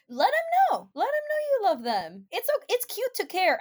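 very high, cutesy voice; playful coaxing. Pretend. Urging listeners to tell friends, cutesy coaxing. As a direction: coax them in a little high-pitched voice.

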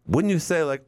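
ordinary talk

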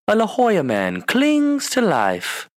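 slow, high dramatic newsreader; Anchorman news anchor. Retold or imitated. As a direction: read it like a movie anchorman. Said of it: "what movie is that from?".

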